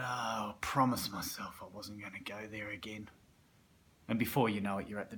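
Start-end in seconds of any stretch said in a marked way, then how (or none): 0.0-3.1 whispered regretful promise; you pleading with yourself at the bottle shop; whispered plea. Retold or imitated. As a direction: breathe it out under your breath.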